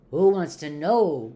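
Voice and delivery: loud, mildly growled challenge; growling. A narrator's reading. Voice inside the shack challenges the visitor, growling. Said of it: "growled a voice from inside".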